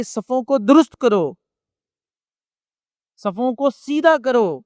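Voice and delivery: solemn drawn-out command to straighten the rows; slow call to prayer rows. Retold or imitated. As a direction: call the rows to order slowly, like an imam before prayer. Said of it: "جب امامت کے لیے کھڑے ہوتے تو کہتے".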